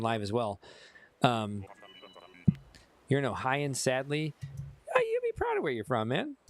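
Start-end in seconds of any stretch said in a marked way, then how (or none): 4.9-6.4 teasing. Acted. Teasing viewer about Ohio.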